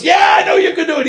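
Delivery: high, fast eager yell; one keen man shouting from the crowd; enthusiastic man shouting. Retold or imitated. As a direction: shout it like the keenest fan.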